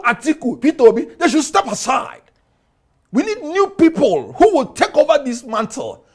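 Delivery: railing at leaders to step aside, voice rising; worked-up near scream. Real, not acted. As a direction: pitch climbing high, pushing each demand hard. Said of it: "you don't need to scream".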